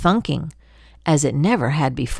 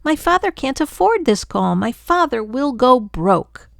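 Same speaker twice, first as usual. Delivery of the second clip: higher, pushed, distressed pleading; upset outcry; 7 semitones higher than usual. Acted. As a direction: cry out in despair about father. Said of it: "my sister screamed".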